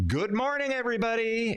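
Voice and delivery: high mock emcee hello; cheesy greeting. Mocking speakers who open with good morning, high and hammy. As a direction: ham up a cliched stage opener. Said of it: "which is so obnoxious".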